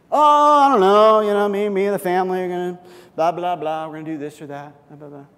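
breezy, offhand reply that fades into blah blah; a coworker brushing it off lightly; vague coworker. Pretend. Coworker tossing back weekend plans, a breezy offhand reply.

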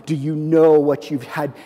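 ordinary delivery

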